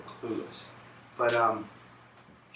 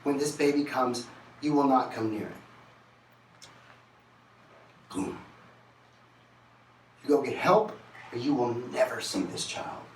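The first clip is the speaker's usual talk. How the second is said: stern ultimatum; 3 semitones higher, 3 dB louder than usual. Retold or imitated. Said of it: "She goes".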